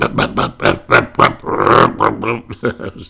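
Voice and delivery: imitated old car starting up; sputtering engine noise. Mimicking the ancient car sputtering to life. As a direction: cough and sputter like a jalopy.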